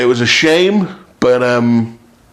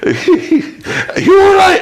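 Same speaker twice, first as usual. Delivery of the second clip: very high, slow, laughing mock voice; a very high, slow question through laughter; high laughing Jimmy; 3 dB louder, much slower than usual. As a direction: stretch it out high, laughing at him. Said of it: "Jimmy comes in and he goes".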